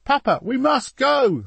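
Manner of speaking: put-on very high, slow, loud boy's plea; pleading. A narrator's reading. Boy to papa, urging him to flee, pleading. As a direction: drag out the plea, pitched high. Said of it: "Soleil pleaded".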